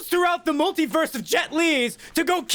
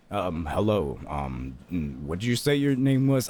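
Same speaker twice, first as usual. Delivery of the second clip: dazed stoned guest; 3 dB quieter than usual. Acted. David Michael Draymond answering the host in a dazed drone. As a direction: drag it out, zoned out on DMT.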